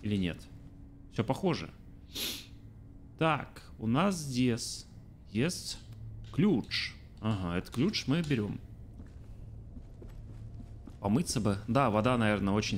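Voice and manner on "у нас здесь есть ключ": normal talking voice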